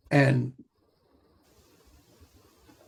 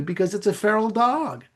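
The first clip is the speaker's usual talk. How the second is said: ordinary talk, nothing marked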